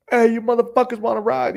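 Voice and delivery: high, loud hawker's call; rowdy high-pitched street yell; yelled greeting. Retold or imitated. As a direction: holler it to passersby. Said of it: "I yell".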